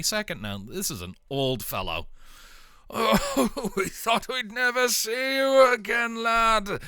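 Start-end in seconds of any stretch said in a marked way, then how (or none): 2.9-6.8 put-on high, warm old father's voice; an old man choking up with relief; emotional old man. Old man hugs returned son.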